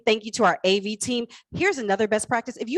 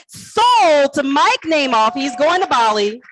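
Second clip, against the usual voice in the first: auctioneer's call; 3 semitones higher, 7 dB louder, slower than usual. Shown as a demonstration. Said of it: "Just say".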